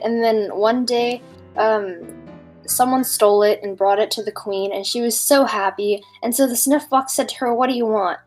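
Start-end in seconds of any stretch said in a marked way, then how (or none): none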